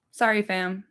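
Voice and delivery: ordinary delivery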